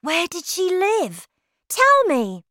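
put-on high, insistent child's demand; eager boy. A narrator's reading. Boy begs grandmother for the witch's home, insistent high voice.

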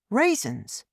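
put-on high child's one-word query; high-pitched childlike puzzlement; puzzled boy. A narrator's reading. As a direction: echo it as a surprised young boy.

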